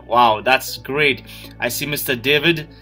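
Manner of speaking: ordinary talk, nothing marked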